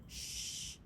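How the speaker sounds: soft unvoiced shush. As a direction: hush the cubs. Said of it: "She's saying".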